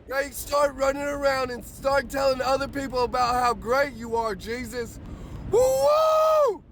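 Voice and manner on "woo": high, loud joyful whoop; ecstatic whoop. Real, not acted. Morning preacher whooping for his viewers, ecstatic whoop. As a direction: let out a wild happy whoop. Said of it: "Man, I get excited about this".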